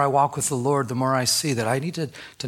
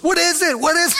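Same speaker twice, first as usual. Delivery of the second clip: high, loud panicked wife; freaking out; 6 dB louder than usual. Retold or imitated. Wife demanding what husband drank, freaking out. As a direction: imitate frantic alarm, pitched way up. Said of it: "she's, like, freaking out".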